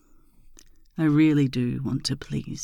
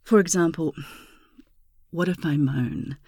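sultry ASMR. Acted. Intimate ASMR seduction talk.

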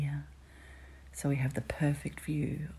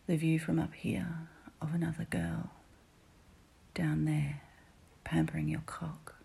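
usual speaking voice

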